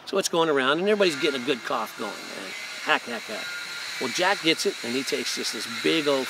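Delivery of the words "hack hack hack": imitated coughing. Imitating the party crowd coughing on a harsh joint. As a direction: hack hack hack, a mock smoker's cough.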